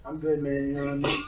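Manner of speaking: sleepy mumble. Retold or imitated. Hendrik mumbling in his sleep.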